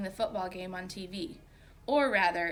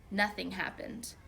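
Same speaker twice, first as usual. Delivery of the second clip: ordinary delivery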